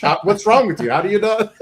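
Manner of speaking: hammed-up baffled clerk; mock exasperation. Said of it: "He goes".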